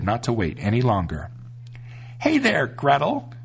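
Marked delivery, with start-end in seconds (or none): 2.2-3.3 high, slow witch call; a witch calling to the little girl. A narrator's reading. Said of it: "she called to the little girl".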